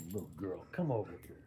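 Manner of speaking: gentle coaxing. Real, not acted. Calling the dog over gently.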